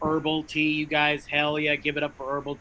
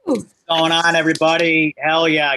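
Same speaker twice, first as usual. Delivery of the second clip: hype greeting; 7 dB louder than usual. Real, not acted. Co-host greets crowd loudly.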